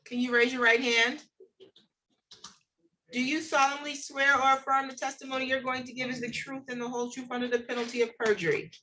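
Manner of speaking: usual speaking voice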